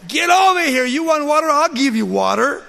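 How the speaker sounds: loud, high furious shout; angry Moses. Acted. Moses yelling at the grumbling people, furious shout. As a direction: bellow at them like a fed-up leader. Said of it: "he's pretty upset at the people".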